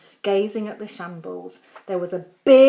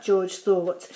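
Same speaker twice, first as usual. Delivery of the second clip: plain reading voice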